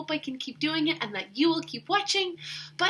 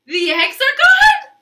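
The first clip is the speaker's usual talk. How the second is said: very high, loud eager question; hopeful Elephant; 11 semitones higher, 13 dB louder than usual. Acted. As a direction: Elephant hoping it's over.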